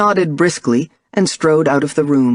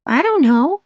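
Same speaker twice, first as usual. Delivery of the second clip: put-on little girl voice; 11 semitones higher than usual. A narrator's reading. Young daughter voiced by narrator.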